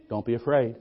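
put-on old prophet calming his servant; calm reassuring prophet. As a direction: settle him down gently.

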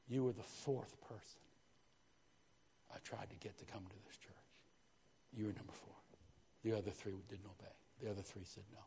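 low, hushed voice of God; a soft, low divine answer, hardly above a murmur; God's quiet reply. Retold or imitated. God quietly telling Pastor Garcia he was fourth, hushed and low.

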